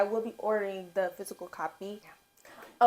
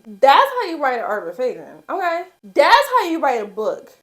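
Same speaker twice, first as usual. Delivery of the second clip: louder, rising to a high shout; excited yelling; 4 semitones higher, 11 dB louder than usual. Real, not acted. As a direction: hype the book up hard. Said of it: "I'm sorry for all the screaming".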